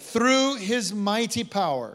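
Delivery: high, loud, slow proclamation; rousing scripture declaration. Real, not acted. Preacher feeds the congregation a verse to repeat, proclaiming loudly. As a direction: call it out for a crowd to repeat.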